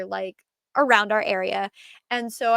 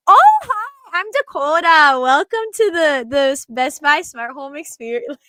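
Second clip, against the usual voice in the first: put-on much higher, louder Minnesota accent; passive-aggressive Minnesota nice; 5 semitones higher, 8 dB louder than usual. To an Eagles fan walking through, a higher sugary Minnesota accent. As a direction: lay the fake sweetness on thick. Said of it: "It was so bad".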